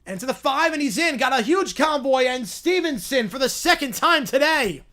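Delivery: excited touchdown call. Acted. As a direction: sell the touchdown like a big broadcast moment.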